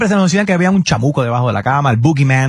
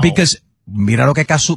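ordinary talk, nothing marked

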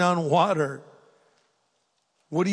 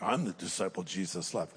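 a touch of smug boasting, low and soft; lightly voiced self-satisfied disciple; mock boastful; 8 semitones lower, 10 dB quieter than usual. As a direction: say it lightly like bragging. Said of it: "That almost might sound boastful".

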